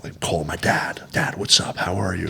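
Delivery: hushed whisper on the phone. Retold or imitated. Son quietly calling his dad, whispering. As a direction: barely breathe it out, voiceless.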